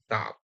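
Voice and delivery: "কাপ" with U sounded as আ; U-as-a demonstration. Shown as a demonstration. Teacher showing the U rule, "কাপ" with U said as আ. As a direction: say "কাপ" with an আ vowel. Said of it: "আয়ের মতো হচ্ছে".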